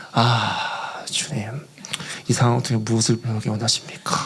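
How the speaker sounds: low, hushed plea to God; quiet sighing prayer. Retold or imitated. Missionary alone before tea fields asking God, quiet sighing prayer. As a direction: sigh it out low, resigned. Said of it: "조용히 가서 거기 홍차 실론티 밭을 보면서".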